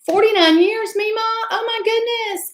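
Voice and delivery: high-pitched, delighted astonishment; real amazement. Real, not acted. Streamer reacting to a viewer's forty-nine-year marriage, amazed. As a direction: exclaim it high, marvelling at the news.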